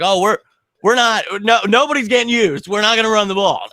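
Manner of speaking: high, loud, sarcastic disbelief; exasperated mock voice. Fan mocking the coaching staff for abandoning the run, high and exasperated. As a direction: throw up your hands at the coaches. Mocking the team's play calling.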